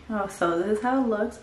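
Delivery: ordinary talk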